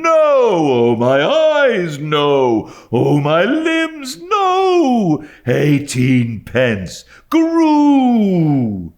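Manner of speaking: high, loud rising-and-falling tune; wind-like wail that mounts up and falls; sing-song wailing. A narrator's reading. Old dealer haggling down the price, sing-song wail. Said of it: "delivered in a sort of tune".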